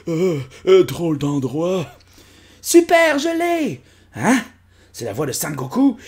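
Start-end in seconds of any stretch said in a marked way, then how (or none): 2.6-4.4 much higher, louder cartoon voice; high cartoon kid voice. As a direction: squeal it like a thrilled little cartoon character. Personnage trouve la pierre. (voix aiguë de personnage de dessin animé)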